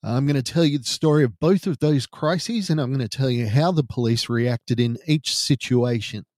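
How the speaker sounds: ordinary talk, nothing marked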